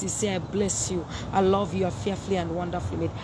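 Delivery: normal talking voice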